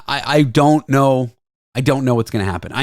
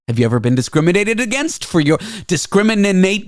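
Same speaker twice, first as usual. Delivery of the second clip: ordinary talk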